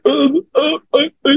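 high, halting stuck syllables; mock someone too scared to get words out; imitated scared stammer. Heckler scared by the pitcher's challenge, imitated stammer. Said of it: "he got scared".

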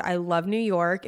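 usual speaking voice

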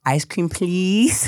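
put-on slow, high wheedling child voice; toddler begging. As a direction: stretch it out like a sweet-talking toddler. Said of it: "She goes".

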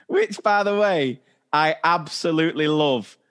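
normal talking voice

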